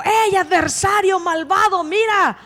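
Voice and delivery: high mock taunt at the king; mock insult. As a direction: hurl a pretend insult, hammed-up. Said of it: "no le dijo". (burla fingida, aguda y agresiva)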